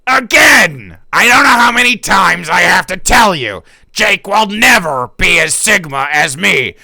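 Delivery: loud theatrical Shakespearean bluster; hammed-up Shakespearean rant. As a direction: boast like a stage villain. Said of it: "my best voice impressions ... Shakespearean play".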